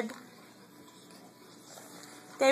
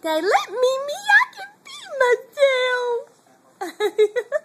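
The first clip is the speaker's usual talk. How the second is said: put-on much higher, fast little-kid voice; voicing the baby; 11 semitones higher, faster than usual. Mother speaking as baby Trey, high quick kid voice.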